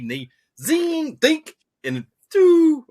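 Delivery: high, slow zing and dink sound effect; imitated line snap. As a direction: mimic the line snapping off, drawn out and high.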